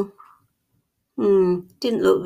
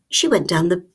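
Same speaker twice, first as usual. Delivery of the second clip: usual speaking voice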